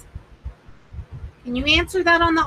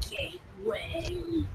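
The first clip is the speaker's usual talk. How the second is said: very slow, much softer eerie voice; imitating the creepy slow voice; 4 semitones higher, 15 dB quieter, much slower than usual. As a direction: breathe it out slowly like the ghost box. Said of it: "I stood up".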